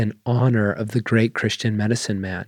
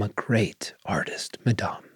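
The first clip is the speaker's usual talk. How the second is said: soft unvoiced whisper, much softer; whispered repeat; 3 semitones lower, 8 dB quieter than usual. A narrator's reading. Babette repeats her claim to the sisters in a whisper. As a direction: breathe it as a proud secret. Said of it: "then repeated".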